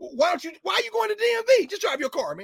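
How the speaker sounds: high, loud mock disbelief; sarcastic, incredulous and fast; sarcastic outburst. Host mocking the DMV excuse with loud sarcastic disbelief.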